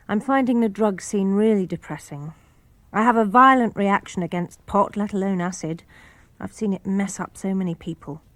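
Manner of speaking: usual speaking voice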